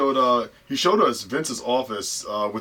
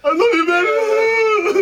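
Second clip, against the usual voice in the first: drawn-out high, loud wail; hammed-up happy crying, stretched very slow; mock tearful love; 9 dB louder, much slower than usual. Celebrating couple both getting signed, mock sobbing declaration.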